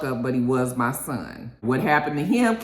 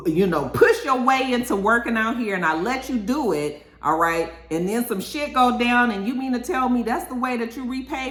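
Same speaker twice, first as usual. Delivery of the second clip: high-pitched, mock-indignant pleading; indignant retelling in a raised voice; acted indignant complaint; 8 semitones higher than usual. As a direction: act out his outraged protest, pitched up. Acting out Sal's indignant confrontation.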